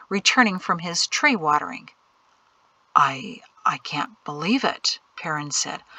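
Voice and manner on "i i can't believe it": slow, hushed, halting words; stunned stammering. A narrator's reading. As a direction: falter in a low, disbelieving whisper. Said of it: "halted Perrin's stammering".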